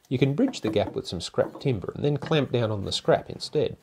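ordinary delivery

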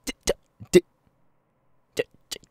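loud then breathy d-stutter; stuttering tic. Acted. Toby's tic interrupts meeting someone, stuttered d-sounds. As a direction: stutter the d uncontrollably, fading. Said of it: "I have Tourette's".

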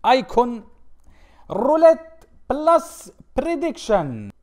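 loud, high announcer call; high-energy show intro; hyped title announcement. Real, not acted. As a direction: announce it big, drawing out the last words.

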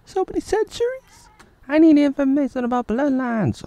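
hammed-up high woman's voice; mock woman's voice. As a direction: squeak it like a silly fake lady. Dubbing the woman again.

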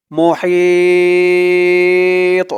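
মুহিত with the হি held very long and slow; long three-alif madd. Shown as a demonstration. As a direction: stretch মুহিত into a long drawn vowel. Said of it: "তিন আলিফ টান মধ্যে আরজি". (মুহিত শব্দে হি দীর্ঘ টেনে তিন আলিফ মদ)

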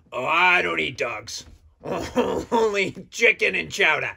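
ordinary delivery

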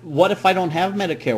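normal talking voice

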